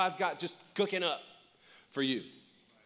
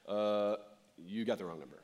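much lower, softer, flat brush-off; dismissive banker; 7 semitones lower, 4 dB quieter than usual. Retold or imitated. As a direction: turn down a crazy loan request. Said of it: "they're like".

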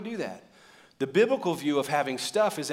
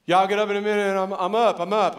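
loud, higher protest from bed; groggy excuse; 7 semitones higher, 8 dB louder than usual. Retold or imitated. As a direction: shout back from bed, insisting you're up. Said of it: "I'm laying there".

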